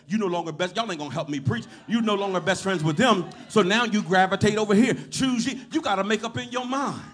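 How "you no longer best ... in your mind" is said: loud sermon building to a shout; full-force preaching. Real, not acted. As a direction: drive it home, louder and louder.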